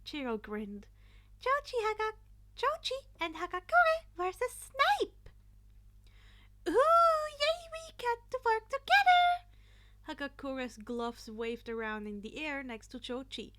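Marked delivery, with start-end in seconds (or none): 1.4-5.3 high, loud chirpy announcing voice; cheerful principal. A narrator's reading. Principal announcing the teams to the class, high cheerful call. 6.7-9.4 very high, loud giddy squeal; excited squeal. A narrator's reading. Hagakure to Jochi, very high excited squeal. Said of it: "she was excited".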